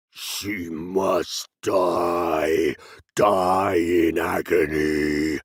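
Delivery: very slow, low, loud demon voice; unnatural basso profundo. Acted. Demon speaking through Rosaria, slow low menace. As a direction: drag the words out from deep below. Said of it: "unnatural basso profundo".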